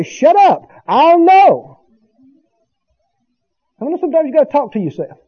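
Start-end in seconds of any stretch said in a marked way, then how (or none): 0.0-1.8 telling doubt off. Shown as a demonstration. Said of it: "you say".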